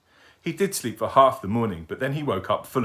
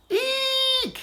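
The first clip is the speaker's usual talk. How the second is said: tiny owl's very high squeaky screech; owlet screech; 4 dB louder than usual. Acted. Baby owl showing off his new screech. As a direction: screech like a baby owl trying to sound grown-up. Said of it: "he screeched".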